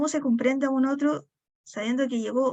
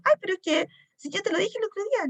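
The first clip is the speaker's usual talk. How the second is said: higher, a lightly voiced husband; mock defensive husband; 8 semitones higher, faster than usual. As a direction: play the husband protesting, pitched up. Husband quoted by wife. (marido a la defensiva, voz más aguda, imitada a la ligera)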